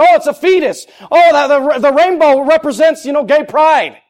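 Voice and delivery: high, exasperated mock protest; full-force preaching. Preacher mimics Christians giving in, high and emphatic.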